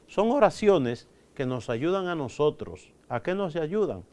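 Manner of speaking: usual speaking voice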